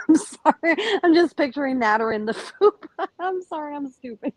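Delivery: high voice breaking up with laughter; laughing apology. Real, not acted. Co-host apologizing for picturing something silly, laughing.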